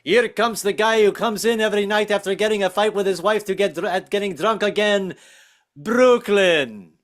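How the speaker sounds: high, run-on announcement of a long name; Greek restaurant man. Acted. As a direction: rattle it off as one name, then land on the town. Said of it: "it takes Nico, like, five minutes to say my name".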